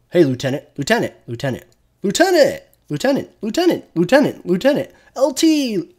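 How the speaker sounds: high, whiny mock NPC chant of lieutenant; mocking game NPCs. Streamer mocking crew who won't stop saying lieutenant, high whiny parody. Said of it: "I shouldn't have to hear".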